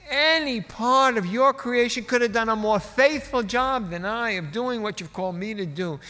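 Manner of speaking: loud, high preacher's cadence; E V Hill. Retold or imitated. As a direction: boom it out to God, easing toward the end. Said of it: "He was booming".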